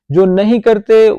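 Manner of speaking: emphatic. Real, not acted. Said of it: "emphatic warning".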